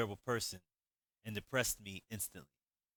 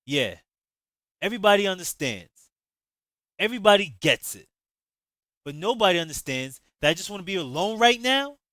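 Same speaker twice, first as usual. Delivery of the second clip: loud, high, bitter snapping; sharp angry outburst; 9 semitones higher, 12 dB louder than usual. Acted. Javon cutting off Danny's sympathy, loud and bitter. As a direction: cut him off with bitter anger. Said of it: "I interrupted sharply".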